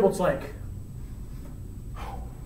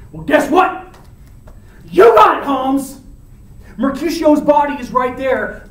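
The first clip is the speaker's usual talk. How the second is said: loud, raised-pitch confrontation; acted angry challenge; 5 semitones higher, 8 dB louder than usual. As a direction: call him out for a fight, building. Romeo challenges Tybalt in rage.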